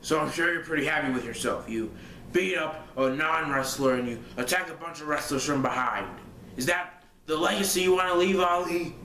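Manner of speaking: Vince McMahon voice. Retold or imitated. Fan retelling the boss scolding Ali, mock booming. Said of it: "I'm gonna try and do it again".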